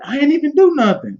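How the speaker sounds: put-on squeaky high kid denial; whiny kid. Kid caught fighting, whining he did nothing in a high voice. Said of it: "it's like".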